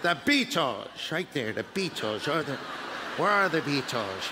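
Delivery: put-on low, stiff old host, searching for the band; host can't find the band, mock confused; Ed Sullivan impression. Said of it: "he just".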